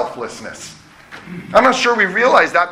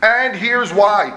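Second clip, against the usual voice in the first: "And here's why" said slowly and higher, with weight on "And"; drawn-out, higher "And here's why"; emphatic; 5 semitones higher, much slower than usual. Shown as a demonstration. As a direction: lean on "And", then stretch "here's why". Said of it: "that's my emphasis here".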